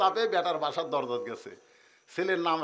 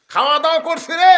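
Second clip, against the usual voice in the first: proud retort; 11 semitones higher, 14 dB louder than usual. Acted.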